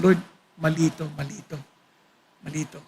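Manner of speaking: almost in tears, sinking to a hush; tearful prayer. Retold or imitated. Preacher retelling his prayer after meeting an old enemy, hushed and tearful.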